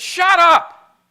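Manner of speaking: high, loud shouted commands; leader's rebuke. Acted. Preacher as their leader silencing the people, shouted rebuke. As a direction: shut the crowd down, word by word. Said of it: "Their leader just turned to them and said".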